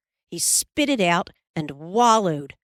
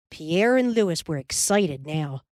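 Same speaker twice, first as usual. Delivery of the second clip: usual speaking voice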